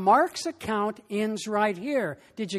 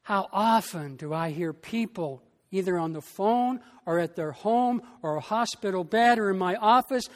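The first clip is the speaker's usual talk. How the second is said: ordinary delivery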